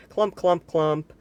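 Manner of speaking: loud, high stomping clumps. A narrator's reading. Narrator stomps out Mister Noisy's loud footsteps. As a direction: stamp each clump out heavily.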